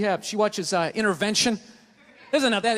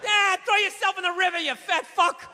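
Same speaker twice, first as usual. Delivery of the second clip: heckling; 9 semitones higher than usual. Real, not acted. Yelling at the TV.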